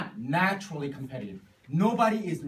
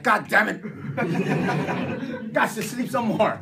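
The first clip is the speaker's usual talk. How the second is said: loud, raised cursing; mock frustration; 5 semitones higher, 7 dB louder than usual. Sleeper sees partner still sleeping, loud mock frustration. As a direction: curse it loudly for comic effect. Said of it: "You're like".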